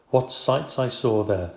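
ordinary delivery, nothing marked

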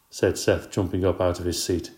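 usual speaking voice

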